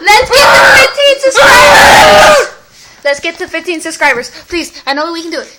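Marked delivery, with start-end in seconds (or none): 0.0-2.5 loud drawn-out shout. Real, not acted. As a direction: yell it out, slow and high. Shouting plea for subscribers.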